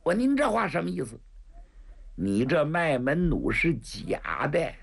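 plain reading voice

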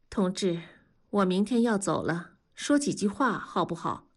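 usual speaking voice

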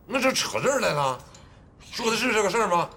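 indignant retort. Acted. Husband objecting to wife's dig at his marriage, indignant. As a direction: push back at being dragged off topic. Father indignant in family quarrel.

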